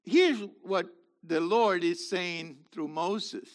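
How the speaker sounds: normal talking voice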